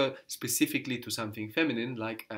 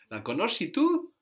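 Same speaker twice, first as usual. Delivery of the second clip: "tu" stressed, higher and rising in surprise; surprised; 9 semitones higher, 4 dB louder than usual. Shown as a demonstration. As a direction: sound like you didn't expect it, hitting "tu". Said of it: "Like, I didn't expect that".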